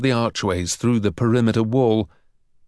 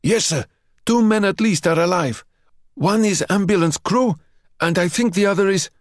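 guard reporting; 9 semitones higher than usual. A narrator's reading. Marksman reporting survivors to the general. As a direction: call it down fast.

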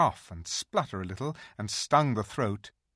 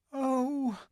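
put-on high, startled girl voice; a frightened little girl gasping; Lucy. A narrator's reading. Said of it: "thought Lucy".

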